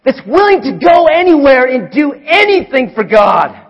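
rally the church at full force; preacher rousing the congregation with a quote, shouting. Real, not acted. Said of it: "what Mike Tolliver said".

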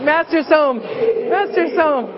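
high, slow, overjoyed dog; excited dog voice. Acted. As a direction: voice the dog greeting its master, drawn out. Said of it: "It's like".

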